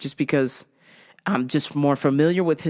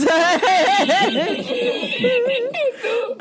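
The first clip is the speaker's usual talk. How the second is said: high shrieking laugh. Real, not acted. Laughing at the hot mess lady, high shriek.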